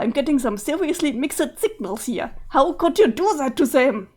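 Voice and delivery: ordinary talk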